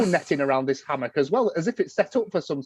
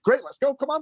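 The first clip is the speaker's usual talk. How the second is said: eager invite; 9 semitones higher than usual. Retold or imitated. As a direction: act out someone keen to get going.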